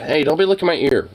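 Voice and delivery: ordinary talk, nothing marked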